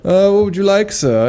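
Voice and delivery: high, sneering snooty waiter; mustache twirling villain. Retold or imitated. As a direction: act the scornful barista. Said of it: "as he twirls his mustache".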